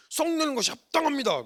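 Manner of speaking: high, cheeky talking-back voice; defiant Jonah. Retold or imitated. Jonah talking back to God, high and defiant. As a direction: sass God like a sulking prophet.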